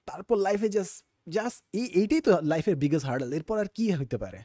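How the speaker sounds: high-pitched pleading inner voice; naive exam-time self. Acted. Speaker mimicking their younger self before school exams, high and pleading. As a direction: act out a fretting student's desperate wish. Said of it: "আমার just মনে হয়".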